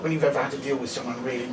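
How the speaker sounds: normal talking voice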